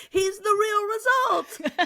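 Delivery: drawn-out, very high squeal; excited squeal. Retold or imitated. Showing the baby off at the office, squealing with excitement. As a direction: imitate an overjoyed, sing-out announcement, high and slow.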